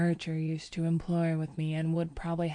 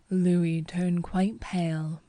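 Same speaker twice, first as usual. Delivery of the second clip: ordinary delivery, nothing marked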